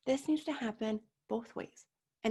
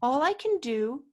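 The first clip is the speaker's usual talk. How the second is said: normal talking voice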